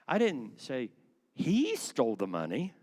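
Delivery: "he" punched loud after a short pause; pause, then hit "he" hard; stress on HE. Shown as a demonstration. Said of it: "Does that have a different kind of a meaning?".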